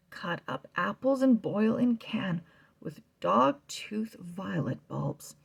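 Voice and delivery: usual speaking voice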